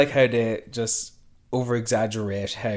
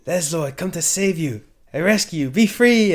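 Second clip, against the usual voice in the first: higher, proud mock-heroic rescuers, louder at the end; lightly hammed-up proud animal rescuers; 6 semitones higher, 4 dB louder than usual. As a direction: play the triumphant rescuer freeing the bat. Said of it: "they're so proud they thought they rescued him".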